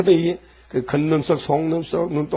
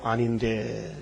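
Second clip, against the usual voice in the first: much lower and softer 아닌데; sly denial; 6 semitones lower, 8 dB quieter, much slower than usual. Acted. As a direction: deny it under your breath. Hypocrite denying outwardly. (겉으로 부인하는 이중인격자, 낮고 작게)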